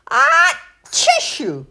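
loud sneeze into words; sneezed words. A narrator's reading. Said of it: "sneezed the maid".

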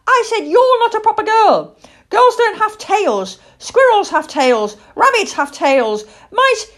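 high, loud, indignant chick; cross young owl. Acted. Owl chick arguing with a little girl, cross and loud. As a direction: argue shrilly as a small owl, point by point.

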